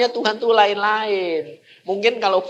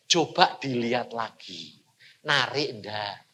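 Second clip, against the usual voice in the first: soft, lower, unhurried question; quiet voice of God; 9 semitones lower, 9 dB quieter than usual. Acted. God softly asks the preacher if the picture still attracts him, hushed. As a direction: gently nudge him to look again.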